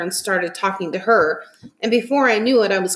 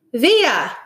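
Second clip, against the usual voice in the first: mild calling shout; raised, higher call of a name; 5 semitones higher, 5 dB louder than usual. A narrator's reading. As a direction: call out to someone across a crowd. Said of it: "shouted Dad".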